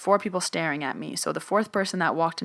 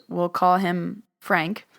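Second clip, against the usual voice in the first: ordinary delivery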